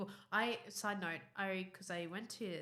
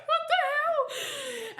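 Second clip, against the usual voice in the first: high laughing what the hell, slowed; laughing disbelief; 10 dB louder, much slower than usual. Real, not acted. Friend laughing at discovering her famous. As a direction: squeal it through real laughter.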